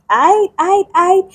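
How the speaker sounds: high, stuck stammer on each I; Moses stammering. Retold or imitated. Moses stammering high when God calls him. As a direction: trip over the word I like a scared man. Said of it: "And Moses is like".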